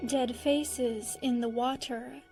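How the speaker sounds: ordinary delivery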